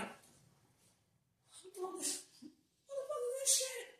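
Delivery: high, hushed mock bewilderment; quiet, high-pitched and baffled; baffled self-talk. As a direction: squeak out your retold confusion softly. Retold baffled self-talk while watching.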